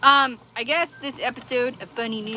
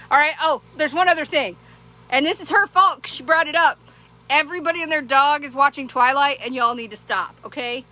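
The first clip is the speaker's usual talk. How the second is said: ordinary delivery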